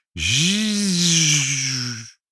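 long, high buzzing zoom; imitated whoosh. A narrator's reading. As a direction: whizz away like something vanishing. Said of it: "Een vreemd zoevend geluid".

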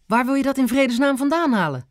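high-pitched incredulous question; aghast. A narrator's reading. Grandma asks Ben about explosives, aghast. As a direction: demand it in alarmed disbelief.

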